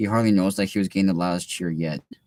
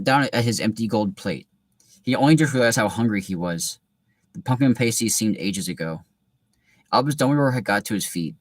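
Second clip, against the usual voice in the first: usual speaking voice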